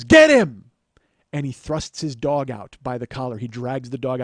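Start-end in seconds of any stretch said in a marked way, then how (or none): 0.0-0.7 loud, high bark of a command; sudden shout. Retold or imitated. Bro siccing his dog on the driver, loud shout. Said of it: "he goes".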